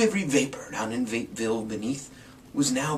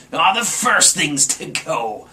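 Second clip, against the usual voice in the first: slow, very loud drawn-out gloat; grinning Grinch; 4 semitones higher, 5 dB louder than usual. Acted. Grinch eyeing the stockings, slow wicked gloat. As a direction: savour each word wickedly. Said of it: "he grinned".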